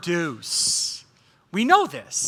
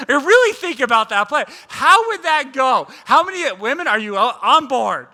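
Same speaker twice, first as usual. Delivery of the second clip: normal talking voice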